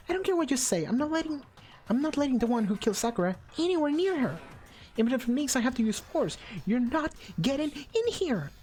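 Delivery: put-on high, accusing young girl, rising to a yell; shrill teen girl accusing everyone; high Hina voice. Hina defiant.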